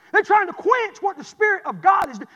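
preacher crying the line out again; preaching shout. Acted. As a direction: drive it home with a shout. Repeated with a shout.

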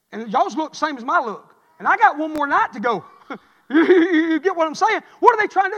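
loud, high preacher's cry; full-force preaching. Acted. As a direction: build to a rousing preach with a laugh.

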